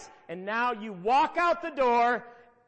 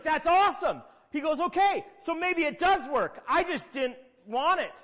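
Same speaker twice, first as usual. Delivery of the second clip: hopeful realization; 5 semitones higher, 4 dB louder than usual. Retold or imitated. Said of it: "he got hopeful".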